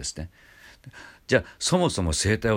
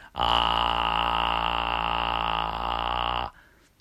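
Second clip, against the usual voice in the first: low creaky fry on a long ah; slow rattling edge voice; relaxed low vocal fry; 8 semitones lower than usual. Shown as a demonstration. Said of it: "でも声帯をちょっと震わせる".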